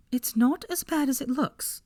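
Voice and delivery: high, slow excuse; a sheepish plea; sheepish Hermione. Acted. Hermione excusing her work to Harry, high sheepish.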